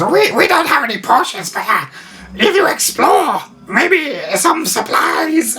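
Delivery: very high, slow squeaky rat creature. Acted. Game master voicing the rat leader answering the party, high squeak. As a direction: pipe it up like a tiny rat. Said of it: "He goes".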